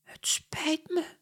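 small, sorry whisper. Acted. Katja apologizes to angry Mia, whispering. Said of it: "fluistert Katja".

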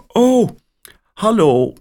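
surprised caller on the line; woman's voice. A narrator's reading. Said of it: "zegt een vrouwenstem".

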